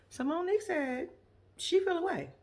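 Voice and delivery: ordinary talk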